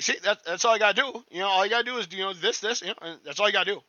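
imitating a coworker's high-pitched voice, pitched well up; high-pitched Jeff voice. Retelling how Jeff coached him, in a high-pitched imitation. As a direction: mimic the high, chirpy boss coaching a trainee. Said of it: "he's got a high-pitched voice".

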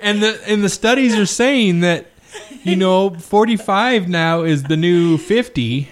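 real laughter breaking into the sentence; the giggles. Real, not acted. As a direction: struggle to stay serious while giggling. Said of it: "we just really have the giggles".

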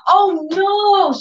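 high, sad little "oh no"; daughter's dismay. Retold or imitated. Daughter seeing something is wrong, high dismayed "oh no". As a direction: imitate the child's disappointed voice. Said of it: "we saw her like".

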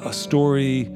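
normal talking voice

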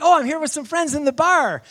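high, loud hollered reply; shouting back. Retold or imitated. Buddy outside the bar to preacher, loud call. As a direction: call it over the noise.

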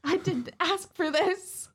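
high, drawn-out mock outrage; indignant outburst. Motel maid appalled at the job, mock indignant.

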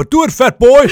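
high, loud laughing shout of a jeering crowd; mock crowd yell. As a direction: bellow it like a room of women heckling. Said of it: "sidder der fem hundrede kvinder og råber".

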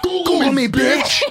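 put-on high loud bratty kid; bratty kid yell. Quoting nephew movie line.

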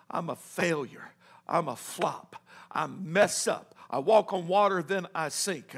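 very low, hushed despair; broken self-reproach. Acted. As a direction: barely voice it, as a defeated man. Peter alone, continuing his despair.

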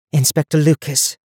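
calm, controlled repeat of the name; composed and measured. A narrator's reading. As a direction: say it steadily to settle someone panicking. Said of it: "Donia repeated calmly".

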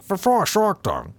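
high, exaggerated Dutch imitation; mock Dutch accent. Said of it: "and she's like".

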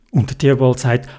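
plain reading voice

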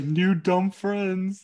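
slow hammed-up King Shark; dumb shark voice. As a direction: stretch it like a slow happy giant. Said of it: "He's like".

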